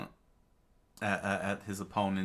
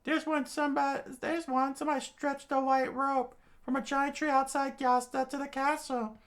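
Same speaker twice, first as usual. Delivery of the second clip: much higher voice, eager and insisting; high character voice pointing the way; excited young woman; insistent girl voice. Acted. Character insists there is a rope.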